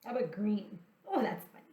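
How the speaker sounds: ordinary delivery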